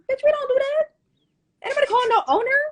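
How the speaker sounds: very high, incredulous mock retort; incredulous scorn. Storyteller answering friend's idea of calling owner, incredulous scorn.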